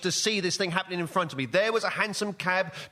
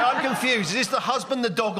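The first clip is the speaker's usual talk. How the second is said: usual speaking voice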